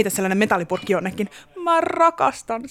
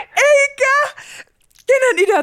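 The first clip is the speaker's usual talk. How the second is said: very high, louder gasp of glee; thrilled squeal; 5 dB louder, slower than usual. Acted. Hears robbery as ASMR.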